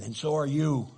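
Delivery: jab it back, up from the apology; retort. Acted.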